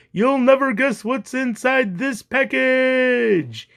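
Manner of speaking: high, slow shout, last word stretched. A narrator's reading. Captain circling the cat with package, drawn-out shout. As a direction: call it out teasingly, holding the final word.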